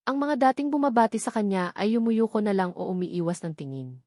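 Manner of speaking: ordinary delivery, nothing marked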